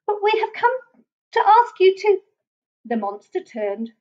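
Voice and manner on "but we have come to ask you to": high little Basil voice; high-pitched, tentative request; small timid petitioner. A narrator's reading. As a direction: pipe up politely to the monster. Small Basil timidly addressing the monster.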